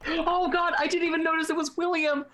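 high-pitched overdone lament; mock anguish. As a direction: wail it softheartedly, hammed up. Mock grief over troll's fate.

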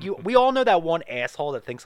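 ordinary delivery

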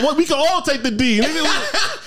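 high-pitched, laughing mock yell; mock screaming. Teasing a friend about screaming on the train, mock yell. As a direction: imitate someone hollering at his boy, cracking up. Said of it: "You screaming at your boy like".

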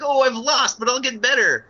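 high, loud comic character cry; Wimp Lo impression. Guest quotes Kung Pow to co-hosts, high hammed-up character voice. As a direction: do a high-pitched, hammed-up loser's boast. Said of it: "there's Wimplo who's like".

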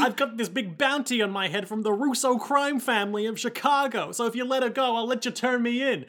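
put-on high-pitched young boy; unbroken boyish voice; Jacuzzi. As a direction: plead in a child's high voice. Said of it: "One whose voice is yet to break".

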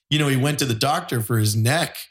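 ordinary talk, nothing marked

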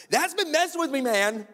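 very high, loud complaint; hammed-up, high-pitched retold whine; upset outburst. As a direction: burst out in mock anguish. Retold emotional outburst to boss.

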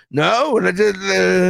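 lightly voiced embarrassed heckler; sheepish heckler. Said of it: "that one heckler was like".

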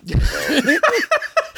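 cracks up laughing; burst of laughter. Real, not acted. Cracking up at his friend's joke, high laughter.

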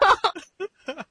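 high giggle; high laugh. Real, not acted. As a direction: laugh again. Laughter continues.

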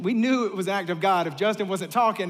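ordinary talk, nothing marked

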